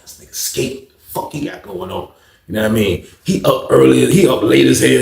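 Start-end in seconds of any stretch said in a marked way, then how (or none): 0.0-2.1 soft, slow under the breath; quietly wondering to himself; muttered thought. Retold or imitated. Narrator wondering about cellmate late at night, under his breath.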